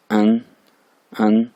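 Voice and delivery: अन as one short level syllable; pronouncing final EN. Shown as a demonstration. Teacher showing the final EN as अन, pronunciation demo. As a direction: model अन clearly by itself. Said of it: "ईएन को, ये, ये final है".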